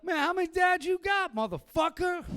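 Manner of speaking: high, loud, mock outrage; mock outraged yell. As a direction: blurt it out in fake shock. Yelling back at the kid.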